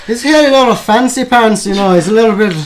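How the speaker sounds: mock Scottish accent. Scottish drinker gossips about a sitcom character, mock Scottish. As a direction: ham up a gossiping Scot. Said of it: "start to speak in the Scottish accent".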